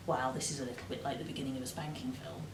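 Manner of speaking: quiet, quick naughty aside; hushed inner thought. Retold or imitated. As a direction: murmur it fast like a secret.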